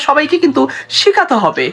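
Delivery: ordinary delivery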